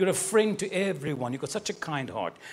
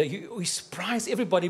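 usual speaking voice

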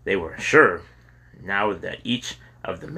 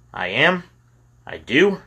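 surprised; 6 semitones higher, 3 dB louder than usual. Acted. Kyle surprised by praise.

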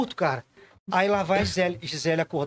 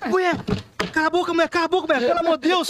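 exasperated plea; 8 semitones higher, faster than usual. Retold or imitated. Husband pleading with screaming wife.

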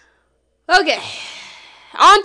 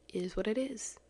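breathy unvoiced whisper of the phrase; 15 dB quieter than usual. Shown as a demonstration. Whispering the phrase back to herself, resigned whisper.

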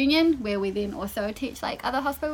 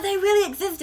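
excited; 9 semitones higher, 4 dB louder than usual. Retold or imitated. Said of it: "I got so excited".

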